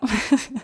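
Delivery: short self-mocking laugh; amused laugh. Real, not acted. Joking that her accent is one of a kind, amused laugh. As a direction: laugh briefly at your own accent.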